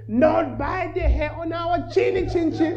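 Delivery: defiant little pig up high; tiny stubborn piggy squeak; squeaky pig. Acted. Pig refuses the fox.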